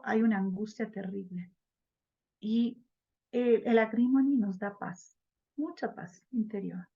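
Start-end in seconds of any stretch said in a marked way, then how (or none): none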